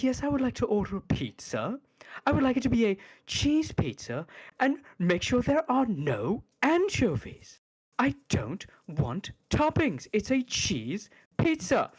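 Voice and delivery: mock a pompous pizza order with swooping emphasis; Sargon, rising-then-falling emphasis. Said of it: "he has that tone no matter".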